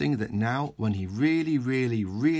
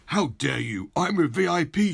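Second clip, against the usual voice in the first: louder, rising outraged mayor; indignant shout; 5 semitones higher, 5 dB louder than usual. Acted. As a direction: bellow it out of the window. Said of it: "shouted the mayor".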